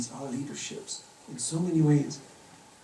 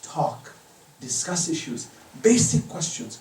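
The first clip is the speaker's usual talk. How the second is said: ordinary delivery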